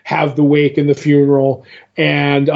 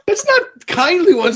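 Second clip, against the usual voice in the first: much higher, fast, put-on goofy voice; mock dim counter voice; 14 semitones higher than usual.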